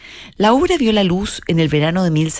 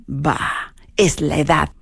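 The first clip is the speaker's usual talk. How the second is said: dismissive whisper; slower than usual. Acted. Susanna whispering a dig at Marchelina's age. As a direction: brush her off under your breath. Mocking sung duel quotes.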